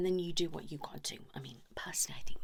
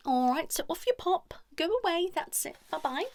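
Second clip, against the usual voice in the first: higher, louder breezy send-off; a louder, higher dismissive bye-bye; shooing them off; 11 semitones higher, 7 dB louder than usual. Acted. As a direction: shoo them out cheerily. Shooing the couple out.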